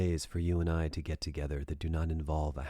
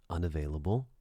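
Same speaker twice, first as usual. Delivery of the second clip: ordinary delivery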